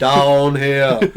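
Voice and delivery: slow, loud booming prophet voice; booming arrogant alien overlord. Pretend. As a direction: boom it out like a godlike alien. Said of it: "Big booming voice".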